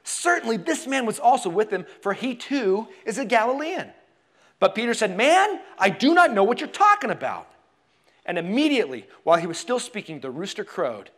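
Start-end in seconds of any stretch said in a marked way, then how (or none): 0.0-3.9 higher-pitched, emphatic insistence; insistent accusation. Acted. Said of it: "still another insisted". 5.2-7.5 loud, high-pitched protest; heated denial. Acted. Peter's third, heated denial.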